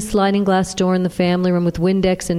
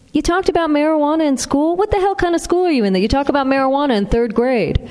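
much higher, fast, incredulous outrage; outraged dad; 8 semitones higher, faster than usual. Acted. Father outraged at what his son learns in school, fast and high. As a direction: rant at the school in disbelief. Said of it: "Outrage at his children's schooling".

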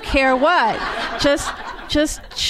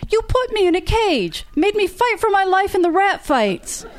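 much higher, outraged pitch; heated accusation; 9 semitones higher than usual. Acted. Rachel accuses Trent of the rat fights, outraged and high. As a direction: hurl it at him.

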